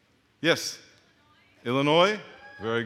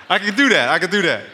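excited; 4 semitones higher, 10 dB louder than usual. Real, not acted. Crowd says hello back.

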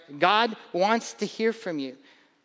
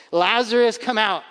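calling Lazarus out, higher and louder; 4 semitones higher, 6 dB louder than usual. A narrator's reading. Jesus calls his dead friend from the grave, loud call. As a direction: call him out of the tomb. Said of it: "called in a loud voice".

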